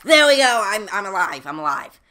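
loud, high burst of relief; relieved triumph. Real, not acted. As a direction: exclaim it like a narrow escape. Relief at surviving in game.

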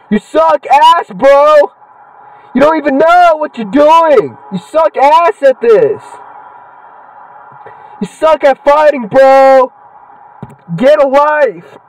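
high, slow jeering boos; drawn-out taunting jeers at a game wrestler; mock heckling. Real, not acted. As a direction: heckle the wrestler like a rowdy fan.

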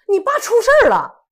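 high and loud, breathless alarm; urgent alarm. Retold or imitated. Man tricking a schoolchild, high urgent alarm. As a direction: blurt it out like terrible news. Man luring child with lie.

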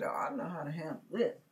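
quiet, quick scheming aside to himself. Retold or imitated. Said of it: "he said".

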